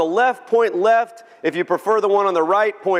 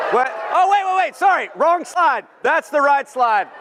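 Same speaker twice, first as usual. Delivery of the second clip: mock surprise; 9 semitones higher than usual. Mock surprise at wrong slide.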